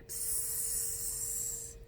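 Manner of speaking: hissing like a snake; quiet snake hiss. Shown as a demonstration. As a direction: hiss the s out soft. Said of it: "and a python".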